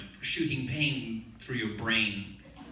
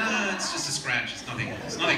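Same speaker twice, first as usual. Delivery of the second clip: lightly raised, dismissive Monty Python bravado; 5 semitones higher, 3 dB louder than usual. Retold or imitated. Hurt actor waving off the crew, mock-brave and dismissive. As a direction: brush the injury off in a mock-heroic voice.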